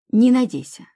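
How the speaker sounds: restrained bark. A narrator's reading. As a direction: snap it back with mild force. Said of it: "рявкнул он".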